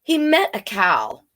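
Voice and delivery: ordinary delivery, nothing marked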